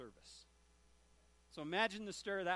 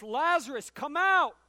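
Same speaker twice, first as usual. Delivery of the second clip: loud commanding call, much higher; 6 semitones higher, 13 dB louder, slower than usual. Acted. Jesus at the tomb, commanding the dead man: loud call. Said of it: "he calls to a dead man".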